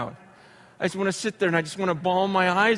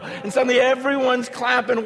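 normal talking voice

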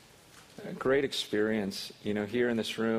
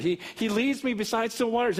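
"He leads me beside still waters" said much higher and louder, fervent; excited preaching; 10 semitones higher, 4 dB louder than usual. Shown as a demonstration. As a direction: burst out with the verse in joy. Same verse, excited retelling.

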